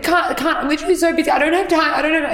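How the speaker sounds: a retold frazzled brush-off; frantic stressed protest. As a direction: blurt it out like you're drowning in work. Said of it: "I was like".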